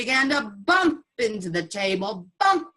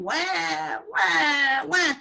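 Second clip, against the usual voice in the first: higher hammed-up baby wails; mock crying; 7 semitones higher, 4 dB louder, much slower than usual. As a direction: wail like the children for laughs. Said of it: "The children were crying".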